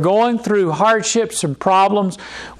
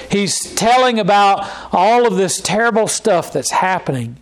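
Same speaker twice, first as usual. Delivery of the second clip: ordinary talk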